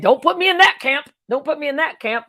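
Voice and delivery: high, loud mock plea; hammed-up panicked begging; mock pleading. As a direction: pretend fear of being put in a camp. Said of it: "We used to be afraid".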